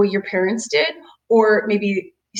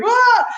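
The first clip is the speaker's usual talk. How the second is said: sudden high, loud cry of fright; startled yelp; 7 dB louder than usual. Retold or imitated. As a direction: jump at the thunder and yelp. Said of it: "totally startled him".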